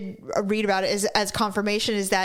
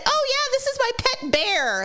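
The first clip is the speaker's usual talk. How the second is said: higher, dopey mock voice of a clueless owner; mock idiot voice; 13 semitones higher than usual. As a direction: play the fool proudly showing off his pet. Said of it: "what idiot said".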